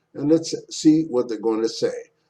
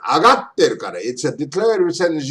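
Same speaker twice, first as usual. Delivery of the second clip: ordinary talk, nothing marked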